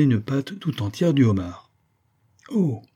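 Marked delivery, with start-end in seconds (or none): 2.5-2.8 high, falling exclamation of discovery; exclaiming. A narrator's reading. Ernest breaking in about his own find, exclaiming. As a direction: exclaim it briefly, pitched high. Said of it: "s'écria alors Ernest".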